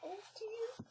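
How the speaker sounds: high, soft whimpering oh dear; frightened. Acted. Melissa frightened by the cat, whimpering. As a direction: whimper in fear.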